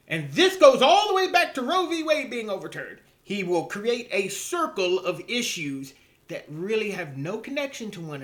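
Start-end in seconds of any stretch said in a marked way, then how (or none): 0.1-3.0 imitating a pundit's loud, high-pitched outburst; mock ranting. Mimicking a pundit circling back to his issue, mock ranting. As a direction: burst out high and loud, then drop off at the end. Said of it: "he's raving and ranting and screaming like a lunatic".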